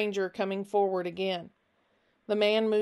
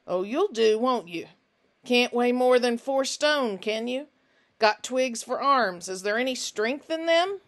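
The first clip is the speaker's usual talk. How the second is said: louder, mocking appraisal of a skinny boy; sneering stranger Finch; 4 semitones higher, 5 dB louder than usual. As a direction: look him over and jeer, high and pushy. Finch sizes Nick up.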